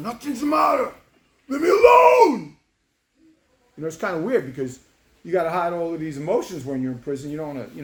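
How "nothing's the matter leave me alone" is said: high, loud crying shout, stretched at the end; raging. Retold or imitated. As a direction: yell it through acted sobs. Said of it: "raging in the pillow".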